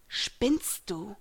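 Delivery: a touch of indignant mermaid; understated irritated retort; lightly hissed challenge; mild hiss. A narrator's reading. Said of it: "zischte die Meerjungfrau".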